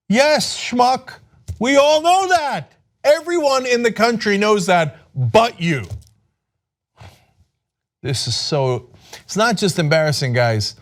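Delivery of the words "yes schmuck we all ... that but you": higher, slow exasperated jab; higher, exasperated retort; exasperated scorn. Real, not acted. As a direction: talk down to the fool, drawn out. Ranting at Trump's obviousness.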